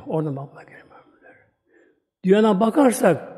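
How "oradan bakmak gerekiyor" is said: soft whispered repetition of "Oradan bakmak gerekiyor"; whispered echo. Shown as a demonstration.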